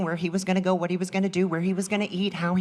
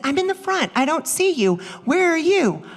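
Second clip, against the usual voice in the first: higher, louder, baffled echo; 10 semitones higher, 4 dB louder than usual. Retold or imitated. Mom at the wrong theater echoing her friend, higher and louder. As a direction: throw the same words back higher. Same words echoed, higher.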